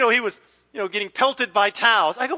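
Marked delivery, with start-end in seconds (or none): none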